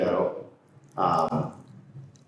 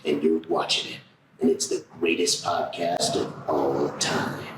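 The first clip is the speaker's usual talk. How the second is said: movie trailer voice; 9 semitones lower than usual. Shown as a demonstration. Asked for a podcast intro, deep trailer voice. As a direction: drop deep and draw it out like a film trailer. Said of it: "I like your movie theater voice".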